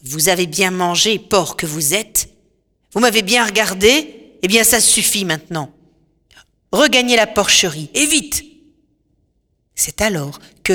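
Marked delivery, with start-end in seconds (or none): 0.0-8.4 louder and harsh, sneering at the men; hard, cutting witch voice. Acted. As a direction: sorceress scolding her guests, hard and cutting. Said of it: "Sa voix est devenue dure et tranchante".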